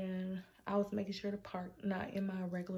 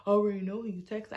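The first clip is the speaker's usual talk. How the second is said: loud fast accusation; 8 dB louder, much faster than usual. Retold or imitated. Boyfriend's accusation, quoted.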